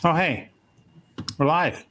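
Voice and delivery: ordinary delivery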